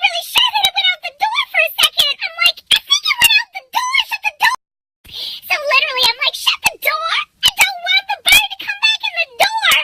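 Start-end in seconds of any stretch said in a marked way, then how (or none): 2.7-4.6 high, fast, urgent mock panic; retold panic. Retold panicked call to shut door. 6.4-9.7 high, hurried mock urgency; retold panic. Repeats her panicked order.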